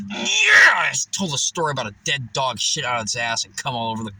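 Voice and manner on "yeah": loud high yeah; triumphant yell. Real, not acted. Proud of himself, loud high whoop.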